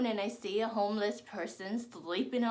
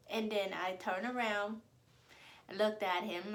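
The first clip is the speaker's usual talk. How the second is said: ordinary talk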